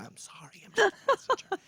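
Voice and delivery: whispered sorry, then a high squeaky mister chair; imitating himself cowering to the driver; mock apology. Passenger mocking his own apology to the driver, hushed then squeaky. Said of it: "And I'm sort of".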